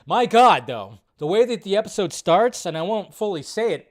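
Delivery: ordinary talk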